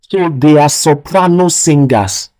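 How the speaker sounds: normal talking voice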